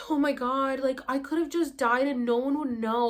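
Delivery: startled awake, gasping and scared; gasp, then blurt in fright; panicked gasp. Retold or imitated. Waking up out of breath.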